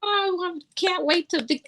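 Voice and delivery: high gushing excitement; squealing high with delight; excited. Retold or imitated. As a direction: gush like a kid at Christmas. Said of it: "I was totally like".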